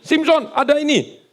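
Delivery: Delilah calling. Retold or imitated. Delilah wakes Samson.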